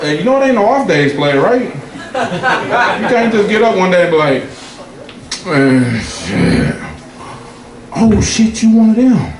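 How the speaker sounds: high, hammed-up preachy advice; mock lecture. Comedian to the woman at the bar, high mock lecture. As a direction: talk down like a street preacher.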